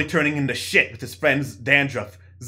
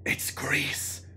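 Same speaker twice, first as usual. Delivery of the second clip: soft theater whisper; 11 dB quieter than usual. Shown as a demonstration. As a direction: breathe it without voice. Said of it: "Let's whisper".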